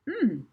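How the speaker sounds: startled high gulp; a nervous hmm, caught out; Molly gulping at the sum. Acted. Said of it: "gulped Molly".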